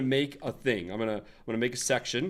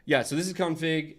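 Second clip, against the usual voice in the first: ordinary talk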